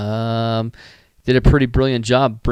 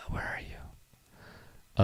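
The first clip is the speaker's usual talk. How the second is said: whisper; 16 dB quieter, much slower than usual. Real, not acted. Searching for the image, muttering.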